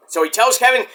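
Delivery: normal talking voice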